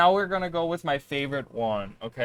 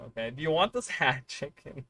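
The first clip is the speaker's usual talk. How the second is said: usual speaking voice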